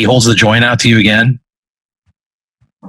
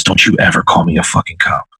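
lower, controlled demon threat; low menace; 4 semitones lower than usual. Acted. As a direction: warn them off, low and close. Demon threatens, right in your face.

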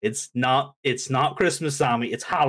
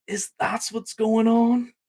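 high, slow mock-surprised question; acting all surprised; 10 semitones higher, slower than usual. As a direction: feign surprise, stretching the question upward. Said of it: "Acting all surprised".

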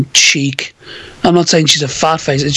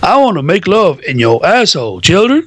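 crooning cartoon character impression; Chef. Comparing it to South Park, mock soul-singer voice. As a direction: put on a soulful cartoon voice, lifting on the last word. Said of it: "Like a chef in South Park".

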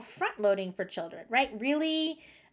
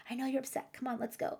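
much softer, calm parent voice; softly coaxing; 8 dB quieter, faster than usual. Shown as a demonstration. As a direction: gently lead the upset child away.

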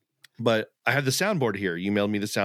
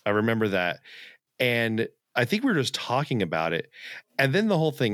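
usual speaking voice

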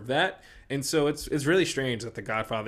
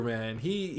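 usual speaking voice

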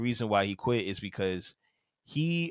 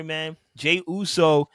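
ordinary delivery

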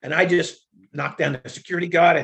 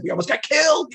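indignant; 9 semitones higher, 3 dB louder than usual. Retold or imitated. Protesting after the near miss.

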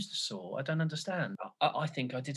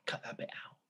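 hushed whisper asking to cut it; whispered plea; 10 dB quieter, faster than usual. Real, not acted. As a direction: whisper it so it's not recorded.

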